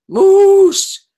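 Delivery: high, loud bellowing moose call. Pretend. Introducing her moose pen to the class, a loud high moose call. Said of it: "That's what they sound like".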